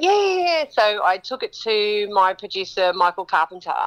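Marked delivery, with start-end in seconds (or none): 0.0-0.6 quick high eager yeahs; eager agreement. Retold or imitated. Friend agreeing to the happy song, eager yeahs. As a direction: jump at the idea. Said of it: "he went".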